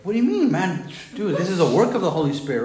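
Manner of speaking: high, loud protest of disbelief; incredulous retort. Retold or imitated. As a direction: throw it back as an exasperated comeback. Acts out his indignant reply to accusers.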